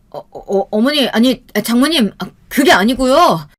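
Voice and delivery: stammering, high-pitched backpedalling; flustered, rising protest; panicked husband. Acted. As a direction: fumble for an excuse in alarm. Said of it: "남편은 얼굴이 새하얗게 질려서는".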